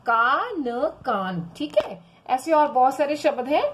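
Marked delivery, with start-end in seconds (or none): 0.1-1.4 slow, stretched syllables, then the joined word; reading demo. Shown as a demonstration. Teacher showing how the word is read, slow demo. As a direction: sound it out slowly, then blend. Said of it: "उसको हमें का पढ़ेंगे".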